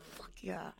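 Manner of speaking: breathy whispered cheer. Retold or imitated. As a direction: whisper it like a secret win. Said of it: "I was like".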